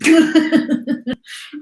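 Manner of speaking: loud, high burst of laughter; real high-pitched laughing fit. Real, not acted. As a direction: break up laughing mid-reading. Reader breaks up laughing.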